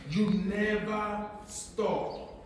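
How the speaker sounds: "You never stop", drawn out slowly with weight on "never"; deliberate, spaced-out "You never stop", long on "never"; slow emphatic repeat. Real, not acted. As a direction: stretch "never", then let "stop" fall.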